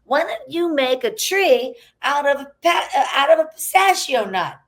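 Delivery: high, excited producer pitch; imitating producer. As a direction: pitch the idea eagerly. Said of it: "he'd be like".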